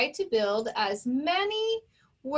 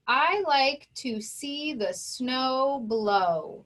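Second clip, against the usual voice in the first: sentence read slowly, word by word; much slower than usual. Shown as a demonstration. Teacher reading a practice sentence to students, slow and clear. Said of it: "let's take a look at our first sentence".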